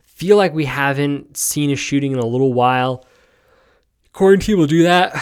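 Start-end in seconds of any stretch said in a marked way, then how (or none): 4.1-5.2 said through a big yawn; words stretched by a real yawn. Real, not acted. Host apologizes to listeners, speaking mid-yawn. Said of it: "Sorry, I'm yawning".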